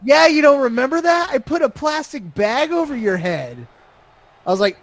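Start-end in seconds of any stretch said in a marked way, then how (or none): 0.0-3.7 high, emphatic retold voice; mock insistence; imitating the insistent woman. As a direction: pitch it up as her, insisting. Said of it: "She's like".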